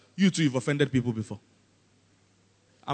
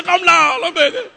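high, loud mock pleading; comic coaxing shout; laughing plea; 11 semitones higher, 9 dB louder, slower than usual. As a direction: call him back through laughter. Acts onlookers pleading through laughter.

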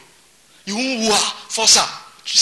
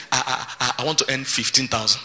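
timid mumble; 6 semitones lower, 6 dB quieter than usual. Retold or imitated.

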